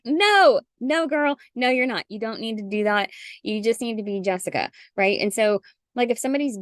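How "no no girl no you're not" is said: sassy high-pitched no; emphatic no. Real, not acted. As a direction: shut the idea down firmly. Emphatic refusal to listener.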